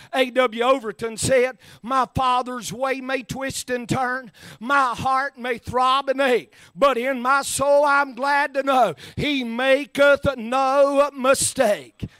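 poem quote swelled into a shout, slowing on no mistake. Shown as a demonstration. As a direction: preach the verse again with rising force. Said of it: "Can I say it again?".

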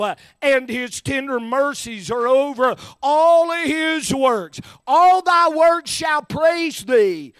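loud, slow, swelling scripture reading; psalm read in full preaching voice; preached scripture. Acted. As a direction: build the psalm to a high cry.

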